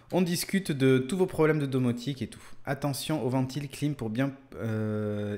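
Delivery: normal talking voice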